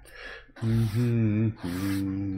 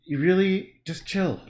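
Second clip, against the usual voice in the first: higher, louder pleading at the dog; exasperated at dog; 5 semitones higher, 7 dB louder, slower than usual. Real, not acted. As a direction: beg the dog to calm down. Scolding the whining dog.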